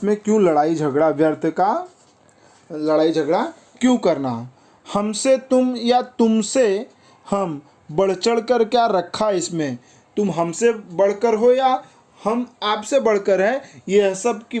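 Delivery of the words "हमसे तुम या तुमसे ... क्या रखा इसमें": poem recitation. Acted. Teacher quoting the verse on who is greater, poem recitation.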